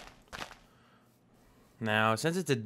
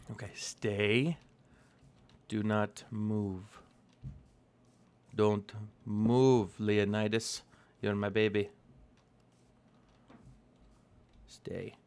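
soothing; 3 semitones lower, 3 dB quieter, slower than usual. Real, not acted. Calming horse, ordering stay.

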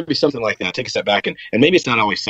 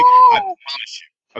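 much higher and very slow, stretched out; drawn-out high promise; 6 dB louder, much slower than usual. Shown as a demonstration.

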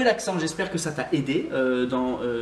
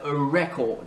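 "record" said REC-ord; stress on first syllable; slower than usual. Shown as a demonstration. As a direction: hit REC in "record", slowly. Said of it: "c'est la première, euh, syllabe". (« record » accentué sur la première syllabe)

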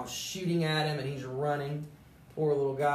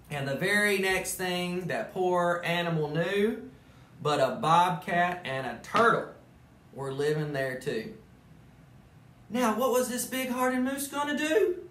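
lively storybook narration; animated read-aloud; reading to children; 4 semitones higher, 4 dB louder than usual. A narrator's reading. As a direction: tell it like a bedtime story to kids. Reads Dr. Seuss story aloud.

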